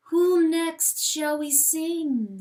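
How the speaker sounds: looming voice choosing the next name. A narrator's reading. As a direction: intone it like a chant. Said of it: "says the voice".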